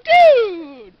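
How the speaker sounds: high, very loud, falling shout; outraged mock yell. Retelling her protest to Lucas, outraged yell. Acting out own outrage after slap.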